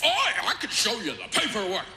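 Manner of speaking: hammed-up know-it-all clerk; mock smug salesman. Salesman quoted insisting.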